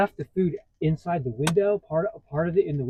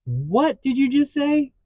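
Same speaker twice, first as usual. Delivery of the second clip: retold disbelief; 11 semitones higher, 8 dB louder, slower than usual. Retold shock at worker's reply.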